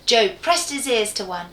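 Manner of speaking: plain reading voice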